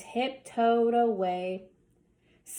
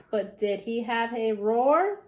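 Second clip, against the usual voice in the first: usual speaking voice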